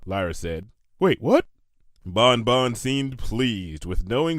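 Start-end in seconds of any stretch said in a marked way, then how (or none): 1.0-1.5 higher, louder startled question; startled double take. A narrator's reading. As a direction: snap back in surprise. Lyra caught off guard.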